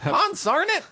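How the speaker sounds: put-on high cranky old-timer grumble; old prospector. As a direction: curse like a grumpy gold miner. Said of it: "I'm really an old prospector".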